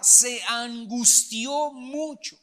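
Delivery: "se angustió mucho" said slowly and heavily; weighty emphasis. Real, not acted. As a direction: lean hard on "se angustió mucho", drawing each word out. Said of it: "escucha la palabra".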